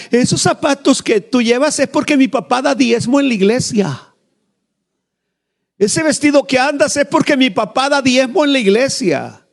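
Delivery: high, mock-snooty kid's voice; taunting girls. Pastor voicing church girls taunting his daughter, high snooty voice. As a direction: sneer it like spoiled church girls.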